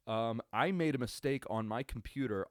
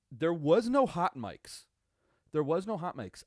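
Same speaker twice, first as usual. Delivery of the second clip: exasperated rant; 6 semitones higher, 7 dB louder than usual. Real, not acted. Ranting about Fox censoring.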